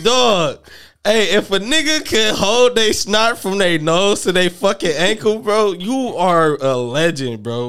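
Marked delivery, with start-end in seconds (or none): 1.0-7.4 excited. Real, not acted. Hyped about snot to ankles.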